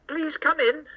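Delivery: ordinary delivery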